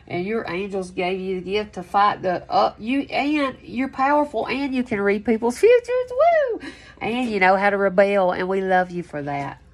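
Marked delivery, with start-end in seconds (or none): 6.1-6.6 a very high, loud woo; excited whoop. Real, not acted. Reader cheering Taurus's powers, excited whoop. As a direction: let out a happy whoop. Celebrating the reading.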